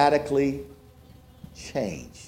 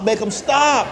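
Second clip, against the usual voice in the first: desperate plea; 7 semitones higher than usual. Retold or imitated. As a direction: beg the second "make 'em stop" harder, rising. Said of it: "I'm like".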